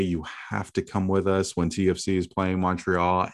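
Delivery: normal talking voice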